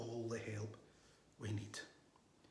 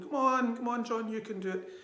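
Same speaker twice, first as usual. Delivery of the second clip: higher, louder cheer from the poolside; 9 semitones higher, 6 dB louder than usual. Retold or imitated. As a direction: egg him on like big brothers at the pool.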